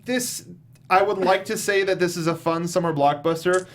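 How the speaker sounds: ordinary delivery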